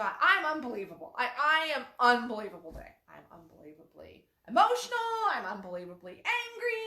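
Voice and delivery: loud, fast, overblown "I'm unbelievable"; hammed-up proud declaration; big over-the-top announcement; emphatic loud "unbelievable". Shown as a demonstration. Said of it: "just go".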